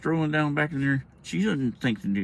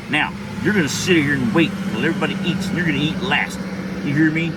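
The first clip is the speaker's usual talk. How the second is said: louder, forceful scolding; retold stern parental order; stern mom laying down law; 3 semitones higher, 5 dB louder than usual. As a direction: deliver the punishment as an angry mother. Angry mother lays down punishment.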